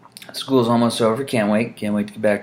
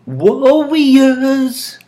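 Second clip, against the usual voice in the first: high, loud, stretched-out call; The Warriors taunting call; 8 dB louder, much slower than usual. Acted. Quoting The Warriors movie, drawn-out taunting call. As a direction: call them out, dragging the name long. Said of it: "the movie The Warriors".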